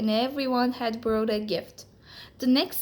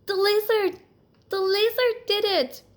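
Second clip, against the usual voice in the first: much higher, louder excited exclaiming; a mouse overjoyed that the magic worked; 11 semitones higher, 5 dB louder than usual. Acted. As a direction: burst out in happy disbelief. Joyful realisation.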